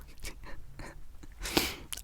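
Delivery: breathy laugh slipping out through the whisper. Real, not acted. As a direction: laugh at yourself under your breath. Laughs at herself mid-video.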